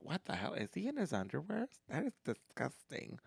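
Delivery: hushed, appalled onlooker imitation; disgusted. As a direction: murmur it in mock disgust. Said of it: "in disgust".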